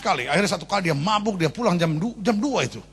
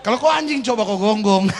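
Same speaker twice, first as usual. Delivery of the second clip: louder, laughing through the taunt; laughing punchline; 4 semitones higher, 6 dB louder than usual. Real, not acted. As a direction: say it while cracking up.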